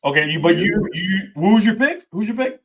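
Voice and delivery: normal talking voice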